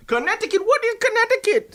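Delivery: high, baffled mock Jamaican squeal; fake Jamaican accent. Guest denying his hometown, fake Jamaican accent. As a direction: feign total ignorance in a Jamaican accent. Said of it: "this is not your real accent".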